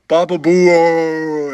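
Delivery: "Papa Boo" stretched very slow and loud; slow drawn-out name. Retold or imitated. Boss scolding the producer, saying "Papa Boo" slowly. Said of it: "it says it all slow".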